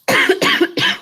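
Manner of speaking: sharp cough, something caught; real coughing fit; choking cough. Real, not acted. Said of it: "I think I'm choking on some granola".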